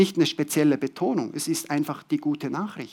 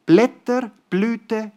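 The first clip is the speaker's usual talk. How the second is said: normal talking voice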